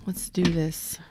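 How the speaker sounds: hushed voiceless whisper. Shown as a demonstration. Reader whispering to live viewers before the spread. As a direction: breathe it like a secret.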